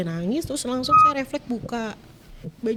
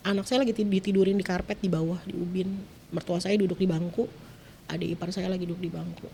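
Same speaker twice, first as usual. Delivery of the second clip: usual speaking voice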